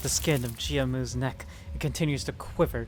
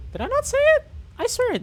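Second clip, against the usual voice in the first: surprised protest; 8 dB louder, faster than usual. Real, not acted.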